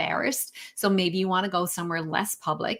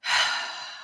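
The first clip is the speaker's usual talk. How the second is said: long audible breath out; demonstrating a slow deep breath; deep exhale; 4 dB quieter than usual. Shown as a demonstration. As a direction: let the breath go slowly. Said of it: "take a few breaths".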